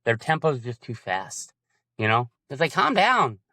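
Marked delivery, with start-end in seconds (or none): 2.7-3.4 mock impatient coach. Mocking a rushed coach.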